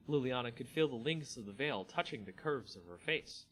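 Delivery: ordinary delivery, nothing marked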